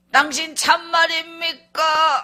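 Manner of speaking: high, loud, drawn-out question; emotional outburst. Retold or imitated. Wife won over by husband's weeping, loud drawn-out cry.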